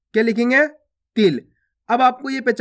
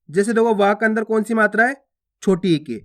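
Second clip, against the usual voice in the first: normal talking voice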